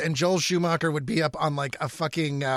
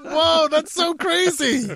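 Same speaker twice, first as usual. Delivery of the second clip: high, slow, fake-amazed whoa; mock amazement; 9 semitones higher, slower than usual. Mocking the plot twist: high, slow fake amazement. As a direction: ham up being amazed, high and drawn out.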